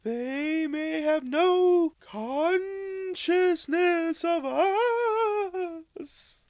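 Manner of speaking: high, slow, otherworldly spirit; ghostly spirit voice. Acted. Ghost of Christmas Past solemnly summoning Scrooge, high and slow. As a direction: speak from beyond, high and drawn out.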